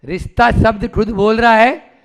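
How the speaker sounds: usual speaking voice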